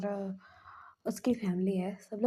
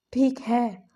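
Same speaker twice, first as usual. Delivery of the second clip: a much louder, firm "ठीक है"; emphatic repeat, louder; 2 semitones higher, 10 dB louder, much slower than usual. Retold or imitated. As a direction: punch out "ठीक है" with force.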